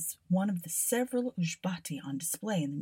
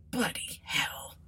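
muttered; 5 semitones higher, slower than usual. Acted. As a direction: mutter it to yourself. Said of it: "the constable muttered".

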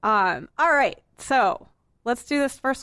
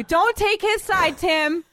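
high, drawn-out protest; slow it down and pitch it up in protest; indignant protest; 8 semitones higher, slower than usual. Real, not acted. Host tells Tim off for backing co-host, high indignant protest. Scolds Tim for siding with Dave.

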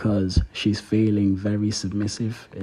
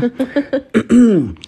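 loud laugh; 14 semitones higher, 8 dB louder than usual. Real, not acted.

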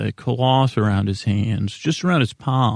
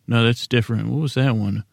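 ordinary talk

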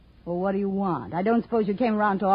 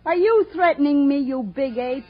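higher and louder, daring him; defiant challenge; 5 semitones higher, 6 dB louder than usual. Acted. Brenda dares De Silva to threaten her, loud and defiant. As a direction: throw it back in his face.

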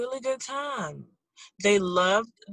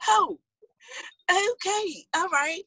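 high-pitched retold reaction; taken-aback acceptance; 9 semitones higher than usual. Host retelling her reply to a suggested price, taken aback. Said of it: "And I said".

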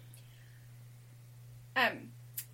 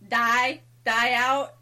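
"Die" hit loud, then "die out" loud with a rising end; stress on die; 9 dB louder, slower than usual. Shown as a demonstration. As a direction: punch "Die" to sell the pun. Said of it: "Get it?".

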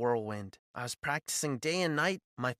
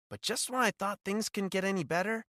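plain reading voice

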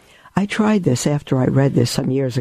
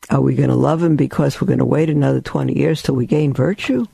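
ordinary talk, nothing marked

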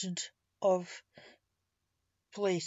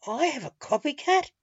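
pleasantly flattered surprise, higher and louder; raised, louder, flattered delight; 6 semitones higher, 6 dB louder than usual. A narrator's reading. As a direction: lift it into a flattered question. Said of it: "feeling flattered".

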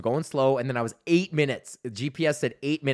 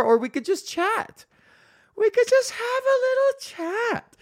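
squeaky, slow, very high sing-song; drawn-out falsetto tease; silly high voice; slower than usual. Acted. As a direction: coo it like a cartoon. Jokey coaxing to patrons.

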